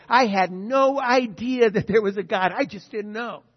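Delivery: loud protesting cry; loud mock excuse to God; pleading excuse. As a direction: protest your ignorance loudly. Imagined excuse before God.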